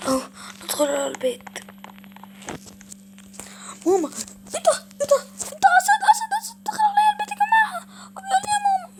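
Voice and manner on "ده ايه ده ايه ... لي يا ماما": very high, loud panic; panicked cry. Acted. Mother seeing a lion at home, screaming in panic. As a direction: shriek that a lion is in the house.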